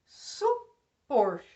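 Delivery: "supor" split into su and POR; "supor" in two separate beats, ending on POR; stress on last syllable. Shown as a demonstration. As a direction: lean hard on the end of "supor". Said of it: "Última sílaba mais forte".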